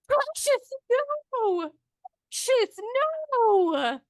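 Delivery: a strange creature wailing for its pet; creepy voice in the darkness. Acted. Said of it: "You hear a voice in the darkness go".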